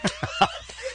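high squealing laugh; shrieking laugh. Real, not acted. Comedian cracking up at his own impression, high laugh.

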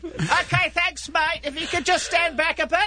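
high-pitched imitation of a sound guy; mock mic technician. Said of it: "The guy did keep turning the mic down".

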